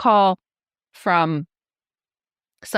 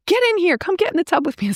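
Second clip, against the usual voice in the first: eager call; 12 semitones higher, faster than usual. Retold or imitated. Navin calling eagerly to Marie.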